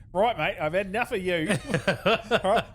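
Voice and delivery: mock Australian army guy. Friend imagines the army guy as an Aussie, a laughing mock-Australian challenge. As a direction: play a brash Australian squaddie squaring up, half laughing. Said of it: "Reed's suddenly Australian".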